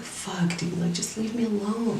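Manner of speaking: imitating an upset outcry at held-back volume; retold tearful scream. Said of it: "I'm screaming and I'm crying".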